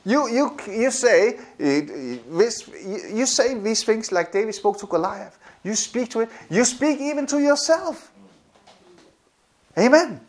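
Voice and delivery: ordinary talk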